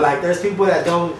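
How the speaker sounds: usual speaking voice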